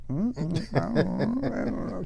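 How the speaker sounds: high, mock clueless mumble; ensign salute. Marines dodging the gunny's question, mock clueless ensign mumble. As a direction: play the dumb shrugging ensign. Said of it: "everybody does the ensign salute".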